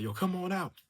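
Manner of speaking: mock a freaked-out call, pitch jumping up; imitated freak-out. Reviewer retelling the father calling someone out, mock freaking out. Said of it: "he's freaking out like".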